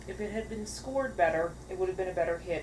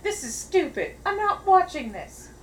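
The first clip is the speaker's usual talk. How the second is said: loud scoffing; 11 semitones higher, 8 dB louder than usual. Retold or imitated. Mocking promo reaction.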